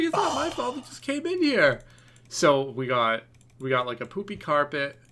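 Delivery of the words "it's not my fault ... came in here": high, pleading "not my fault"; imitating his own whiny self; mock whiny defense. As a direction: squeak out an excuse like a caught kid. Defending himself to his wife.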